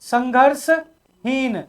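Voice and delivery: संघर्षहीन stretched very slowly; slow teacher's answer, संघर्षहीन drawn out. Shown as a demonstration. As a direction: let संघर्षहीन unfold syllable by syllable. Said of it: "यही आपके क्या है?".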